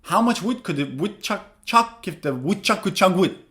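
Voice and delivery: woodchuck line rattled off quickly; teacher rattling off the woodchuck twister; fast. Shown as a demonstration. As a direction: say the whole twister fast in one breath. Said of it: "Now say it fast".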